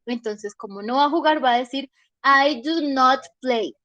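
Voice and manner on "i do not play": slow, each word of "I do not play" pressed separately; a stubborn kid's slow, firm "I do not play"; emphatic refusal. Shown as a demonstration. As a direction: spell out "do not" slowly to insist you won't play. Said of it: "está haciendo énfasis en que no".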